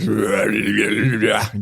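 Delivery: retching gag noise; rough gagging heave; dry heaving. Real, not acted. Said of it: "I don't know what the fuck that noise was".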